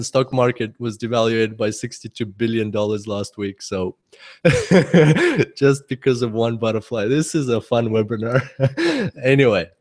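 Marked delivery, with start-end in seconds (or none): 4.4-5.5 higher laugh; real laugh. Real, not acted. Laughing at own joke. 8.5-9.2 very high giggle; a tiny high giggle; real laugh. Real, not acted. Laughing at own joke.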